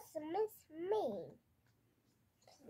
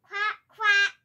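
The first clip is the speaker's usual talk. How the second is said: loud, high duck quacking; 4 semitones higher, 12 dB louder than usual. Pretend. Toddler answering what Olaf did with a loud duck quack. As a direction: shout it like a playful duck. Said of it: "What did Olaf do?".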